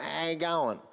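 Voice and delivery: lazy slurred Queensland "how you going"; slurred Queensland accent. Retold or imitated. Pastor mocking Queensland speech, slurred Queensland greeting. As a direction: mumble it like a laid-back Queenslander. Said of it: "everything just becomes more slurred".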